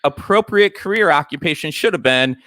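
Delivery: upbeat host voice teasing the next segment; radio break tease. Acted.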